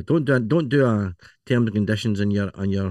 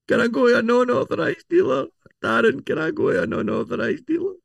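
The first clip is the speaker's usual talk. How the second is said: much higher, silly put-on voice; squeaky high mock voice; 13 semitones higher than usual. Customer pestering Darren in a much higher silly voice. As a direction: play a whiny customer asking the expert.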